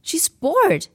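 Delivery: lightly voiced young boy explaining; boy's voice. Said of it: "he said".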